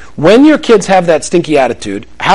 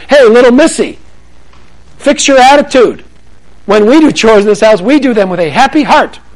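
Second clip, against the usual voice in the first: stern scolding parent; 6 semitones higher than usual. Retold or imitated. Parent scolding a little girl over chores, stern lecture. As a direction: snap the rule at her. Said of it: "if you had taken the standard approach".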